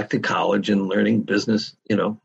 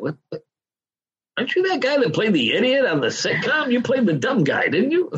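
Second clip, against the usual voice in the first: much higher, squeaky incredulous voice as if spotting a celebrity; mock disbelief; 12 semitones higher than usual. Business people recognizing the sitcom actor, high mocking disbelief. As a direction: play the gawking stranger who can't believe it. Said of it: "would say".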